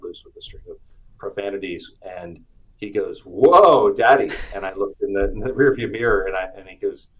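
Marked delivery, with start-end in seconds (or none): 3.2-4.3 put-on high, loud startled child voice; a small boy shocked in the back seat; imitating son. Son in car seat reacting to swearing, loud high startled whoa.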